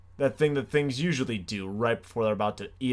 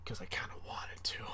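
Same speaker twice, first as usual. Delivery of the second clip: whispered aside; 11 dB quieter than usual. Real, not acted. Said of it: "unvoiced aside".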